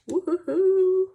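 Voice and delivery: high, loud, slow woo-hoo-hoo; happy singsong whoop about the bank; drawn-out whoop. Real, not acted. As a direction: whoop it out slowly with glee. Celebrating bank deposit.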